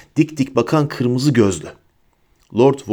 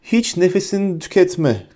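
high, understated shout; 8 semitones higher than usual. A narrator's reading. Harry interrupts Bellatrix's pleading, shouting mildly. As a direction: raise the voice high to cut in. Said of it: "diye bağırdı Harry".

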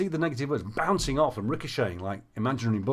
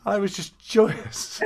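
higher, slow, laughing delivery; laughing with joy; 8 semitones higher, 3 dB louder, much slower than usual. Real, not acted. Coach recalling client's turnaround, laughing with joy. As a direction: let delight bubble into a laugh.

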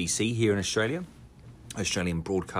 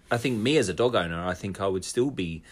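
ordinary delivery

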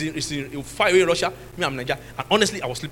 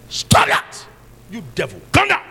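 acted rebuke; 7 dB louder than usual. Pastor rebuking the devil over his daughter's cheek, shouted command. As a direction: shout the orders as if casting out a devil.

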